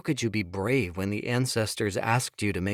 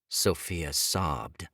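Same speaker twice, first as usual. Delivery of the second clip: plain reading voice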